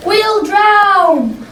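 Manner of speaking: high, panicked cry; frightened cry. A narrator's reading. Peter crying out to the others in the storm, high and panicked. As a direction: cry out as the boat fills. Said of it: "cried Peter".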